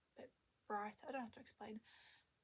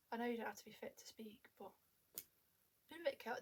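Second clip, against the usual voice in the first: ordinary delivery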